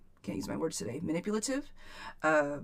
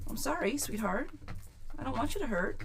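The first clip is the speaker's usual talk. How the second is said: soft, higher-pitched consoling voice; tender sympathy; 6 semitones higher, 5 dB quieter than usual. Real, not acted. Reader to hurting Vanessa, tender sympathy. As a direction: soothe someone who is hurting, gently and high.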